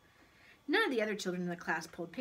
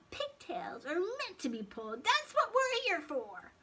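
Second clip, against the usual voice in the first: high, wheedling little creature; high voice stretching out the argument; persuasive pigtail; 10 semitones higher than usual. A narrator's reading. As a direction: make a squeaky case for mischief.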